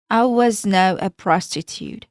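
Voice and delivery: plain reading voice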